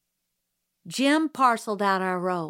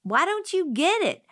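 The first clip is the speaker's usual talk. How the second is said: high, shrieked comeback; screaming; 9 semitones higher than usual. A narrator's reading. Scout screams back at Jim. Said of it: "I screamed".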